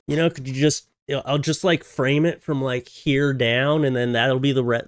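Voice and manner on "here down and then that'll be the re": normal talking voice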